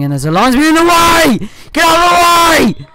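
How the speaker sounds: angry shout. Real, not acted. Commentator screaming at the linesman in the way, angry shout. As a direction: bellow at the linesman blocking the play. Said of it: "sorry for shouting. I got angry".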